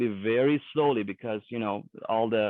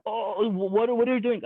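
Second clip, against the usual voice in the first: alarmed, much higher pitch on what are you doing; a sudden rise as if caught off guard by the sight; startled wife; 8 semitones higher than usual. Retold or imitated. Wife startled by foot punching.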